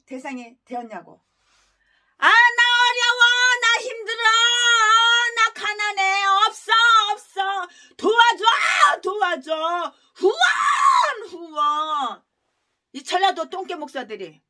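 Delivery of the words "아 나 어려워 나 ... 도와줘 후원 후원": whiny, crying plea for donations, shouting help; mock whining beggar. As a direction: ham up a pastor begging for money. Mocking pastors begging for donations. (후원금을 구걸하는 목사를 흉내 내며 우는 소리)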